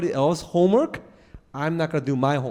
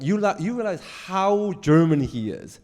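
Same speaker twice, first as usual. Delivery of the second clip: ordinary talk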